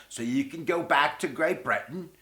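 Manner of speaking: hammed-up, badly faked British accent; mock British, deliberately badly done. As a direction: put on a terrible British accent. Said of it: "That's the worst accent ever".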